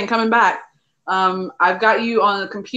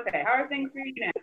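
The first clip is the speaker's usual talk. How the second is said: echoing tunnel; 3 semitones higher, 10 dB quieter than usual. Real, not acted. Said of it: "you sound like you're in a tunnel".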